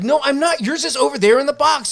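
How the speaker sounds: high, loud protesting voice; exasperated denial. Retold or imitated. Friend protests to Deco that his hoagie is in the box, exasperated.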